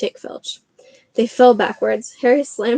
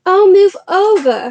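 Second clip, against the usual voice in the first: high, loud, slow impatient snarl; 10 semitones higher, 9 dB louder, slower than usual. Acted. Hermione pushing the boys away from the lock, snarling. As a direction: shove them aside with your voice. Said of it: "Hermione snarled".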